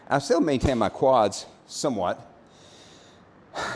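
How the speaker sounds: ordinary delivery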